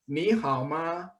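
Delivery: normal talking voice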